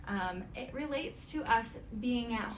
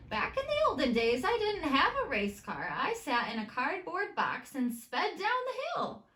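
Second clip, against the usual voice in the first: higher, a touch louder grandma voice; grandma's story; 7 semitones higher, 4 dB louder than usual. Acted. Grandma telling Molly about her cardboard race car, higher voice. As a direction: play grandma remembering her cardboard racer.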